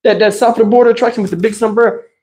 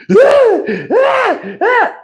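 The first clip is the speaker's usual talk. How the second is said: crying wail; 13 semitones higher, much slower than usual. Retold or imitated. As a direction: howl like a scared little kid. Brother heard crying.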